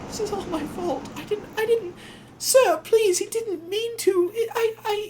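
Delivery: very high, choked and hurried; Evening Star in tears, blaming herself; tearful high voice. Retold or imitated. Evening Star with tears in her eyes, very high and upset. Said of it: "she's like".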